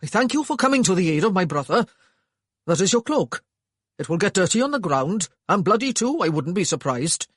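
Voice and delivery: curt, loud Welsh man; cold politeness; stiff. A narrator's reading.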